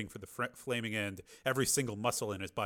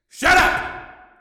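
much higher, louder furious shout; furious snap; 14 dB louder than usual. A narrator's reading. Tommy snapping at his brother, furious shout. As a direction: bite his head off. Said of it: "snapped Tommy".

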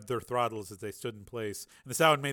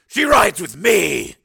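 high, slow, loud possessive declaration; firm loud claim; 8 semitones higher, 16 dB louder, much slower than usual. A narrator's reading. Steele telling Peacemaker that Raven rides with him. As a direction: put your foot down, loud and slow.

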